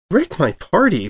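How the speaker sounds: high, bouncy excited pony voice; bubbly Pinkie voice. A narrator's reading. Pinkie greets the returning reporter, high and bubbly. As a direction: go high and bright with glee.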